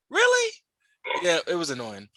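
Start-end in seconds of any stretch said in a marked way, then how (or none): 0.1-0.5 very high, louder retold reaction; exasperated disbelief. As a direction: squeak it out in mock disbelief. Exasperated by repeated silly questions.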